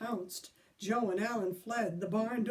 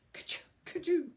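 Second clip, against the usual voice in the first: mock sneezes; 7 semitones higher, 3 dB louder than usual. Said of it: "cried Joe".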